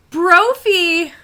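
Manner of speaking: loud, high call to the wolf. Retold or imitated. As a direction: call him in sharply.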